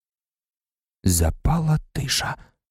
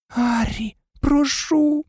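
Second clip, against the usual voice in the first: tearful pleading; much slower than usual. Acted. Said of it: "зі сльозами на очах благала".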